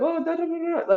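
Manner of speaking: high, sing-song nonsense babble; mock mind chatter. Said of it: "a story in your head of".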